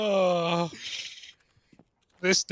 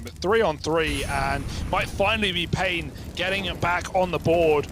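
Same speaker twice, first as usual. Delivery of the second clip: play-by-play. Acted. Live casting of the round.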